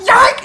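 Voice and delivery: high, loud yike; a sharp yelp; sudden yelp. Retold or imitated. Girl's weave caught in the chair, yelped. Hair ripped off.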